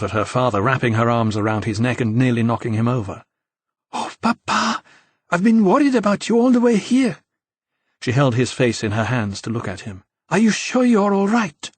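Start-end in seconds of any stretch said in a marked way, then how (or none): 3.9-7.2 put-on much higher girlish voice, louder at the end; a relieved daughter gushing at her papa; voiced Sophie, high and anxious; teen daughter, high and worried. A narrator's reading. Daughter greets worried father. 10.3-11.7 put-on teen daughter concerned. A narrator's reading. Daughter checks on father.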